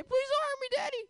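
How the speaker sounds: high, whimpering mock plea; cowering plea. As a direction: plead up high like a scared child. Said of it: "And you say".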